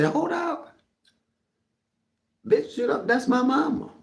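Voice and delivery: high, sassy retold callout; retold check. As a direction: put her in her place, high and sharp. Checking goddaughter about his mama.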